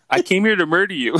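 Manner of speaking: loud, high mock-funny delivery; awkward joking. Man surprising his ex in Hawaii, awkwardly joking. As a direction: blurt a bad joke, loud and high. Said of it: "trying to be, like, funny".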